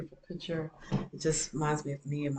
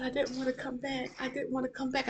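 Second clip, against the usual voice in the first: put-on higher, pleading little-girl voice; little girl's voice; 9 semitones higher than usual. Mother retelling her tearful four-year-old, higher pleading child voice.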